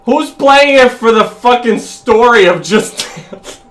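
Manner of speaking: loud, high exasperated outburst. Real, not acted. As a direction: throw it out in disbelief. Exasperated retort in argument.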